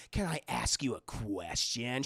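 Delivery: low, slow whispery menace; aggressive killer caller. Retold or imitated. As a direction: imitate a phone stalker turning threatening. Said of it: "eventually he starts getting, like, aggressive".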